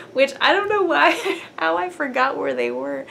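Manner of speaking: laughing while talking. Real, not acted. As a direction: giggle through the words. Laughing about forgotten colors.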